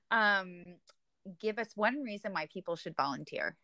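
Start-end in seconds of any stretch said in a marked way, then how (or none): none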